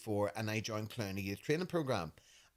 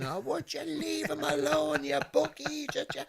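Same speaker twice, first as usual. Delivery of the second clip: dad shouting; 4 dB louder than usual. Retold or imitated. Dad scolding mum for kissing the baby, mock shout. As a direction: bark it like dad. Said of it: "your daddy used to shout at me".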